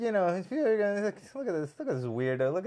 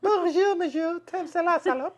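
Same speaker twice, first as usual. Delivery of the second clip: mock French accent; 14 semitones higher than usual.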